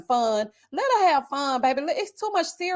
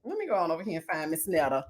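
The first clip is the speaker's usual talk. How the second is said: ordinary delivery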